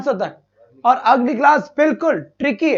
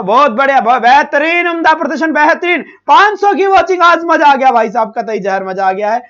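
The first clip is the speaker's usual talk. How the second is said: loud excited praise; 2 semitones higher, 9 dB louder than usual. Real, not acted. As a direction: hype up the class at full voice. Teacher cheers class and viewer count.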